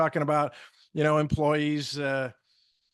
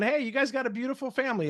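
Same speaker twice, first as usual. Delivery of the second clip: friendly compliment demo; 8 semitones higher, faster than usual. Shown as a demonstration. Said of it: "But to say to someone".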